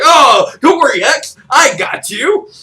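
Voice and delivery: high, loud cartoon hero voice; Mega Man's pet dog. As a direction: play a plucky little sidekick to the rescue, drawn out. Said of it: "Mega Man's little fucking pet doggy".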